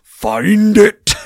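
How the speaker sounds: loud, high mock command yell; hammed-up commanding holler; imitated shouted order. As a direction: yell it like a boss ordering a search. Imitates leader ordering a search.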